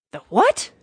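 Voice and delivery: loud, incredulous squawked question; startled, indignant outburst; mild scream. A narrator's reading. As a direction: snap up high in disbelief. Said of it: "screamed the rat".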